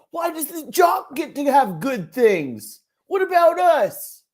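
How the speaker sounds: high mocking whine; whiny outburst. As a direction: whine it, pitch way up.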